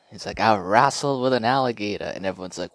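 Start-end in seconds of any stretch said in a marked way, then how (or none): 0.4-2.0 boastful Ali voice, higher and louder; a hint of boxer swagger; Ali impression. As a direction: brag it like a champion. Imitating Ali's boast.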